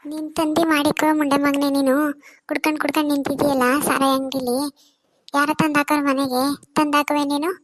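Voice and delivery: loud furious tirade; angry scolding. Acted. Wife shouts at drunk husband outside liquor shop, furious. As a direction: tear into him at full volume.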